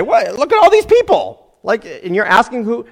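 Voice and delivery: high, loud astonished protest; baffled, voice shooting up; incredulous disciples. Acted. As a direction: exclaim in hammed-up disbelief. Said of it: "even his disciples say".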